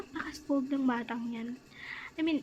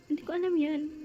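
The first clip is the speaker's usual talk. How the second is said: normal talking voice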